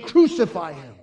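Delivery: mild, loud mock yell; angry crowd yell. Preacher echoing the angry crowd, loud retold yell. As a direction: echo the mob's yell, only half as high. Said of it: "the yelling of an angry crowd".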